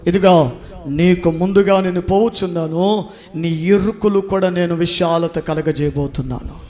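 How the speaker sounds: loud, steady proclamation; proclaiming God's word. Acted. Preacher voicing God's promise, loud proclamation. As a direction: announce the promise with weight.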